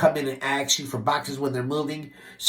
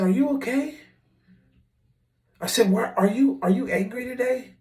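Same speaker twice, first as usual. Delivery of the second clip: high, sing-song fake sweetness; mock concern; 8 semitones higher than usual. Asking touchy coworker Ryan if he's angry, mock sweet. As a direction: needle him with sugary concern.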